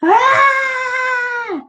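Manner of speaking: high shrieking scream. A narrator's reading. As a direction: shriek in terror. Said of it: "The therapist screamed".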